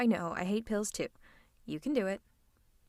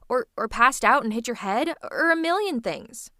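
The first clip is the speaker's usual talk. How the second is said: louder, indignant rant; exasperated scolding; 6 dB louder than usual. Acted. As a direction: scold with mounting agitation, hitting words hard. Villain angrily scolds absent hero.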